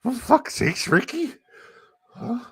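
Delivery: very high squeaky complaint; Bubbles impression. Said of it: "I love Bubbles".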